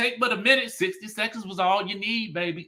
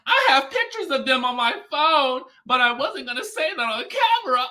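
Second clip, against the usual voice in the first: high, giggly tipsy gossip voice; Courtney on a hot mic; 8 semitones higher, 4 dB louder than usual. Retold or imitated. Courtney on a hot mic, high and giggly. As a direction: play Courtney spilling secrets with a laugh. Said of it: "This is Courtney".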